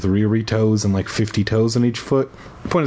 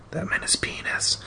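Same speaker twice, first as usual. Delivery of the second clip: breathy unvoiced whispered aside; whispering; 8 dB quieter than usual. Real, not acted. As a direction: drop to a secret whisper.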